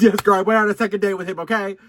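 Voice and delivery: high, loud and fast; loud excited. Real, not acted. Answering viewers' judgment, loud and fast. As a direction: blurt it out, defensive and hyped. Defending going on second date.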